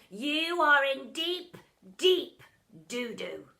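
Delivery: slow, loud menacing warning; barked threat. A narrator's reading. Doctor Dredd warning Bog, loud slow bark. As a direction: bark it with spit. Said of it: "Dredd barked".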